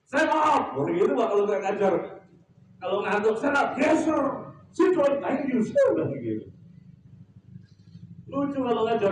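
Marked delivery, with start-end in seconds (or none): 0.0-0.8 high barked military order; mock parade-ground shout; drill command. Imitates waking navy cadets. 3.4-5.9 high, barked military orders and replies; mock cadet drill shout; drill commands. Imitates drilling navy cadets awake.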